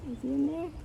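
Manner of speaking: plain reading voice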